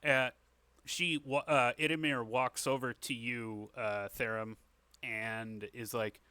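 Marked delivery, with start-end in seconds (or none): none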